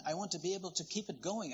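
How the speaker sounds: ordinary talk, nothing marked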